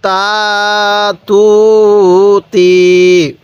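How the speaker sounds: very slow, each vowel stretched long; drawn-out syllables. Shown as a demonstration. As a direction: hold every syllable of তা তু তি. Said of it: "কিভাবে শিখব?". (টেনে টেনে ধীরে উচ্চারণ)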